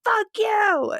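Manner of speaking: high mocking yell back; retold road-rage screech. Yelling back.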